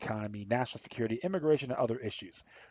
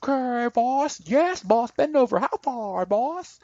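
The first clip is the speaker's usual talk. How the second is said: mocking a fawning underling, much higher and louder; servile yes-man; 12 semitones higher, 4 dB louder, slower than usual. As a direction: squeak it out like a groveling servant eager to please. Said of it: "and have you go".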